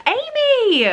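high delighted cry of a name; gasp, then call the name out; surprised gasp. Real, not acted. Spotting an unexpected gift from her friend, delighted surprise.

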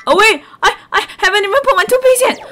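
high, loud, fast panicked shout; startled panic. Real, not acted. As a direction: suddenly realize and panic. Forgot toothpaste, panics.

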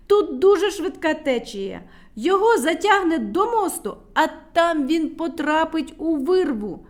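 high, loud warning; urgent shout. A narrator's reading. Medunytsia loudly warns of the fast current. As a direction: warn everyone of the danger. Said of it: "крикнула медуниця".

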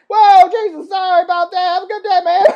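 high, loud backing-off voice; startled apology. Acted. Visitor backing off from a shouting owner, startled high apology. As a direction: apologize in a rush of high-pitched alarm.

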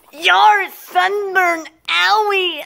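very high, slow, drawn-out wail; pained wail. Acted. Complains of sunburn, high pained wail. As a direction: wail it high and stretch each word. Acted pain over sunburn.

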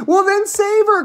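high, loud, desperate plea; cried out. Acted. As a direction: cry out as a frantic mother. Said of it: "cried Missus Salt".

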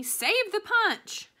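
high, slow, loud yell. A narrator's reading. Gloria yelling at the party in the rain, high loud call. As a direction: shout it out across the yard. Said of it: "Gloria Dump yelled to me".

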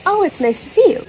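high, sugary polite reply; overly polite. Retold or imitated. Her polite reply to aunties.

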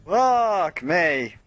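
normal talking voice

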